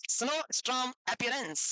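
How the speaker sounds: "snowstorm appearance" drawn out, word by word; slow dictation. Shown as a demonstration. Repeats term slowly for writing down. ("snowstorm appearance" धीरे-धीरे, खींचकर लिखवाते हुए)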